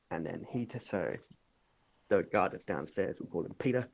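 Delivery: ordinary delivery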